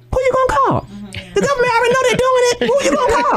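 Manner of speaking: high, mocking scold of a silly fool. Mocking herself for wanting to call someone, high scolding voice. Mocking self-talk voice on phone.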